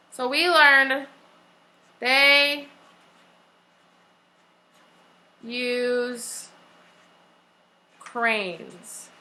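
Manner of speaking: long pauses between each word; dictation with pauses. Shown as a demonstration. Teacher pausing so class can copy. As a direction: leave a gap after every word for writing.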